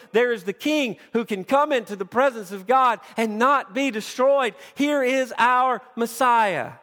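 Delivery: preaching to the congregation, lifted and loud; full-force preaching. Acted. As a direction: proclaim the Messiah with fervor.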